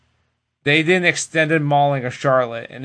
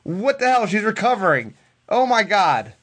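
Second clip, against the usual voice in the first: imitated frantic yelling; 7 semitones higher than usual. Wrestler losing her mind at the referee's delay, mock exasperated yelling. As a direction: play someone losing patience, pitched up. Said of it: "She's, like, losing her mind".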